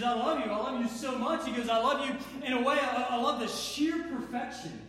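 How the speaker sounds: earnest, lifted pleading of divine love; God speaking lovingly. Acted.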